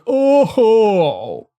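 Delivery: high, loud, long swooping "Oh"; cartoonish wail on a falling "Oh"; Family Guy character impression. Streamer imitating a guy in Family Guy, loud cartoon voice. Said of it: "that one guy in Family Guy".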